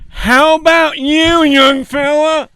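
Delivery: high, loud and slow, hammed-up; booming mock-folksy question; Farley's mock old-timer voice. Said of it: "Farley would do shit like".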